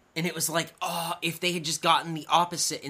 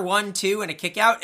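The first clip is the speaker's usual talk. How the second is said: ordinary delivery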